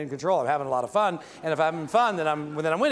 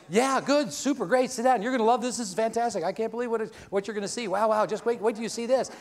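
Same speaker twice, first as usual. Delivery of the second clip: higher, gushing and upbeat, brushing off the rejection; eager salesman; 6 semitones higher than usual. Retold or imitated. Salesman Jeff gushing at a prospect who just refused him, higher and eager. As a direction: pitch it up like a salesman who can't wait to show you. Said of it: "And he goes".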